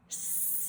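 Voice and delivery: long hissing s, lips spread; s sound demo. Shown as a demonstration. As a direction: hiss a steady snake s. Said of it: "here we go".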